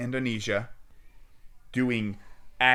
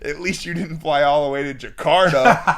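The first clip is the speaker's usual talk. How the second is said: loud, high, breaking into laughter; raised voice tipping into a laugh; amused retort; 4 semitones higher, 9 dB louder than usual. Retold or imitated. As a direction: re-enact the joke, cracking up. Joke leading into laughter.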